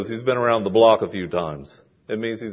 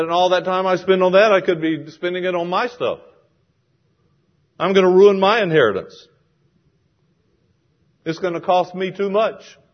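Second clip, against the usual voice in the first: aggrieved protest; 6 semitones higher, 4 dB louder than usual. Acted. Acts out kinsman's worried protest.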